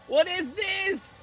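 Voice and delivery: high and slow, stretching each word; can't believe what you're seeing; drawn-out disbelief. Real, not acted. Streamer gawking at an awful movie scene, slow high disbelief.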